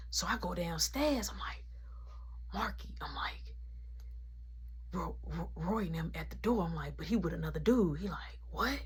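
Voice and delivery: hushed whisper all through. Retold or imitated. Whispering to Marky that Roy is at the door. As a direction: whisper so nobody at the door hears.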